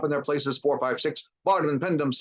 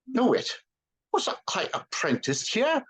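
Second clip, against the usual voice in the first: high, then slow amazed question; amazed Scrooge; 12 semitones higher, slower than usual. A narrator's reading. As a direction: disbelief rising to wonder. Scrooge astonished.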